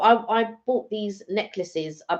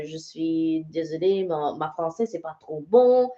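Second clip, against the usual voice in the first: put-on retelling it in French-accented French; halting apology with a French accent. Customer apologising to a stallholder for forgotten French, French accent. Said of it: "I was speaking with a French accent".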